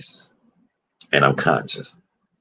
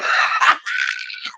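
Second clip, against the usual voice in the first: breathless squeal of laughter; high wheezing laugh; 5 dB quieter than usual. Real, not acted. Cracks up.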